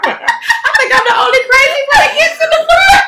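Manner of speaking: high, loud, laughing outcry; loud laughing protest; laughing shout. Real, not acted. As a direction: yell it while cracking up. Laughing shout about front seat.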